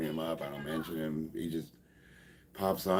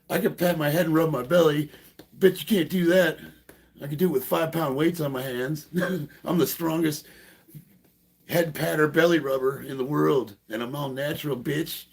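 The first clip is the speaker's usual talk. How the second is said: higher, louder, swaggering taunt; teasing impression of a boastful rival; mock hater boast; 8 semitones higher, 4 dB louder than usual. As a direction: ham up a bragging gym rat. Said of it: "What is he gonna say?".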